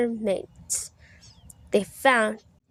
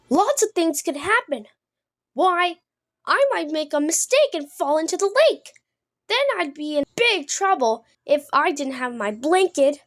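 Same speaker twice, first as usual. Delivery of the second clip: higher, quick anxious little frog; worried baby Kermit; 5 semitones higher than usual. Acted. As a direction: fret aloud like a small frog.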